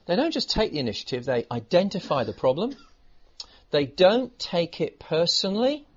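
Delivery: ordinary talk, nothing marked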